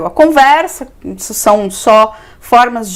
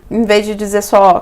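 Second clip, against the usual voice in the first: ordinary talk, nothing marked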